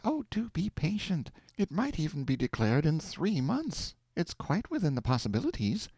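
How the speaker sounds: calm him down like a patient wife; soothing wife. A narrator's reading. Aleck soothes impatient husband.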